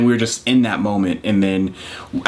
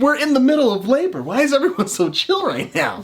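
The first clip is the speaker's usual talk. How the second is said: high, incredulous inner voice; 又高又夸张地模仿自己的惊慌; mock panic; 13 semitones higher than usual. Retelling his thoughts during labor.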